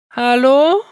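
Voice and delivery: high, loud, scared call into the silence; calling out fearfully, hoping someone answers; frightened call. Acted. Lost boy calls into the empty flower field, frightened and loud. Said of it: "rief er ängstlich".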